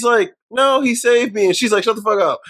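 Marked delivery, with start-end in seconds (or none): 0.5-1.5 higher-pitched mock protest; imitated protesting girl. Girl protests to angry adult. 1.8-2.4 higher-pitched retold telling-off; mock scolding. Adult scolds the little girl.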